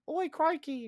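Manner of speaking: high-pitched, hammed-up British exclamation; mock British accent. Egyptian suit talking to wearer, mock British accent. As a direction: do a silly British voice. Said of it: "speak British accent".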